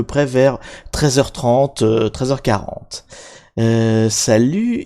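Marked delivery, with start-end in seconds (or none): none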